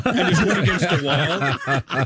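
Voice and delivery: ordinary delivery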